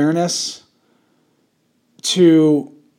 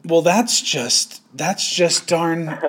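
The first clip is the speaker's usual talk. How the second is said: slow drawl; much slower than usual. Acted. Drawn-out prudish disapproval.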